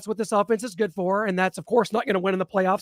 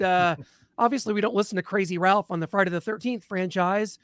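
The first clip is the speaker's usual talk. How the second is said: ordinary delivery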